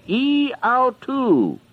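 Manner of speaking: "E autou" high and slow, eh-au-TOO; Greek reading. Shown as a demonstration. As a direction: read "E autou" slowly, lifted high, as Greek. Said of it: "as it reads in the Greek".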